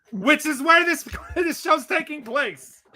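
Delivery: high, laughing through the words. Real, not acted. Host laughing to co-hosts about the hometown venue, laughing. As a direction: chuckle while you explain.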